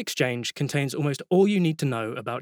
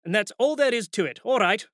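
higher, emphatic, bluff wizard's final word; a lightly voiced boss ending the debate; insistent Ridcully; 7 semitones higher than usual. A narrator's reading. Ridcully lays down the law.

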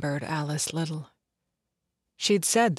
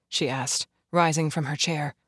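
ordinary delivery

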